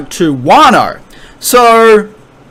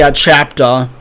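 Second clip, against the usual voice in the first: normal talking voice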